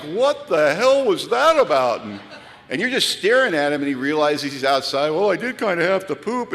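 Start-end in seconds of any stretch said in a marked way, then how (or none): 0.0-2.0 slow, baffled little dog voice; the puppy, dropped on the lawn and baffled; confused puppy. Retold or imitated. 5.1-6.5 lightly voiced sheepish pup; a hint of a sheepish puppy; the puppy realizing he's outside. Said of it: "puppy thought".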